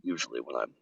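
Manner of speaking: very deep, quiet low register. Shown as a demonstration. Voice actor showing range after warming up, extra-low voice. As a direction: sink to the very bottom, softly. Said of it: "I can get even lower".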